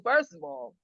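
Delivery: normal talking voice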